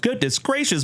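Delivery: high, gushing goodness gracious; excited exclaim. Real, not acted. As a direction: gush with real delight. Thanking for the gift.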